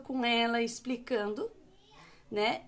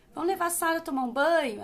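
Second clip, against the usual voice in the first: higher, louder and brighter, quick and playful; cheerful invitation; 4 semitones higher, 6 dB louder, faster than usual. Shown as a demonstration. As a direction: sell bath time like a game.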